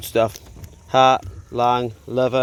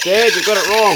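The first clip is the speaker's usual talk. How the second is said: higher, louder mock scolding; imitated telling-off; 6 semitones higher, 7 dB louder than usual. Daughter corrects dad on the coffee, imitated telling-off. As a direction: lightly imitate a daughter ticking off her dad. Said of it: "She'll be telling me off".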